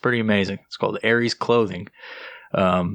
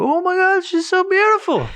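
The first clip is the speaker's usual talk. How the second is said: high squealing gushing voice; mock a gushing dog lover in falsetto; hammed-up cooing admirer; gushing strangers; 4 dB louder than usual. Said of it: "five hundred people can go".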